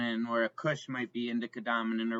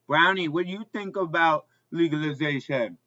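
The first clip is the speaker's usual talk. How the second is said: high, louder pet voice; sweet talk to the dog; 5 semitones higher, 6 dB louder than usual. Real, not acted. Asking the dog a question.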